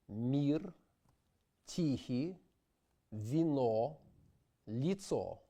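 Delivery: mir, tihi, vino, litso with a clean ee like meat; Russian I sound. Shown as a demonstration. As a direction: sing each word with a pure ee. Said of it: "as in the English word meat".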